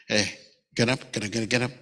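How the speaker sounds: short Hey, low quick repeated get up; retold urging to rise. God telling Moses to get up, low repeated urging. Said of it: "my interpretation of it".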